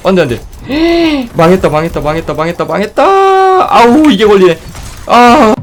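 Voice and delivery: frantic high loud gasping; panicking gamer. Real, not acted. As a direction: panic as the fight goes wrong. Panicking in a game fight.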